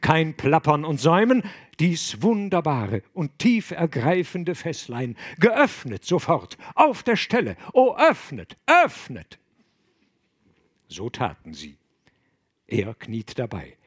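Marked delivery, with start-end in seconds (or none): 0.0-9.4 from urgent to near shrieking high ecstasy; building urgency. Acted. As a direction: climb to a frantic plea. Abbot urges them, mounting frenzy.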